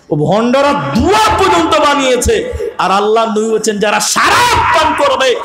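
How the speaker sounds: impassioned sermon shout; preaching at full force. Real, not acted. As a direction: thunder the punishment at the crowd. Fiery warning sermon.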